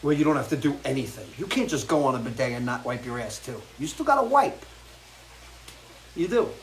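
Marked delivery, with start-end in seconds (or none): 3.8-6.5 high emphatic punchline; emphatic warning. Real, not acted. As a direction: hit it high like a dramatic reveal. Breaking the news about bidets.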